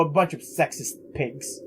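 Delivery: usual speaking voice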